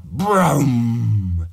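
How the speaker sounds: low, loud boom; explosion boom. A narrator's reading. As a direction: mimic a nuclear blast. Imagining a bomb blast.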